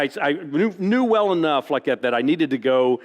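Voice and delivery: ordinary delivery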